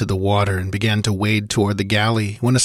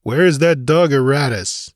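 higher-pitched, demanding question; stern demand; 6 semitones higher, 4 dB louder than usual. A narrator's reading. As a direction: demand an answer from the men. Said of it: "demanded Conan".

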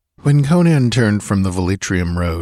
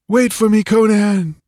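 high, loud desperate call; 14 semitones higher, 4 dB louder than usual. A narrator's reading. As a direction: shout to him through the trees. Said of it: "The voice became more distinct".

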